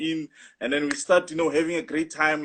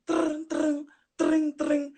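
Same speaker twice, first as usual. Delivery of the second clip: high mock ringing tring tring; playful phone ring sound effect; phone ring imitation; 8 semitones higher, much slower than usual. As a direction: mimic a ringing phone, pitched up.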